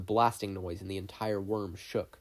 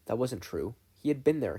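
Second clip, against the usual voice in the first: usual speaking voice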